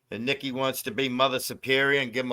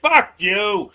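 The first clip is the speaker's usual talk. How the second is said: loud, higher retort in imitation; mock defiant comeback; 6 semitones higher, 6 dB louder than usual. Tonic answers Willie's defender, imitated loud and defiant. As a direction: throw it back, hamming it up.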